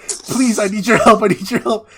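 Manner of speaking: high, loud mock plea for help; desperate pleading. Man begging the rental girlfriend at her door, loud mock pleading.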